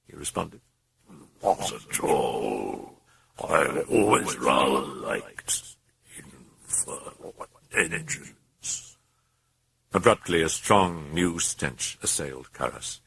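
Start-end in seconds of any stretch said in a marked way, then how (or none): 1.4-9.0 very slow, low demonic whisper; slow low rasping whisper. Acted. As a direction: savour the words like a threat.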